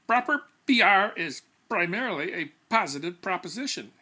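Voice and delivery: labialized plosive P on each alliterating word; popping every P. Acted. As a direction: spit out every P in Proper P R is primarily a positive proposition. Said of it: "always labializing each P for added emphasis".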